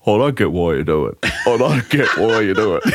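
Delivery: imitated baffled referee voice; puzzled referee impression, laughter creeping in; Jared Maxwell. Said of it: "I can't hear his voice now".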